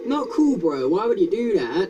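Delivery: high loud complaint; upset yell. Real, not acted. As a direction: call out the enemy. Enemy appears.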